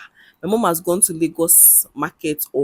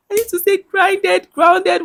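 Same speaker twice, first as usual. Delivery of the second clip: high, laughing through the words; amused at your own word slip, voice pitched up with laughter; 14 semitones higher than usual. Real, not acted.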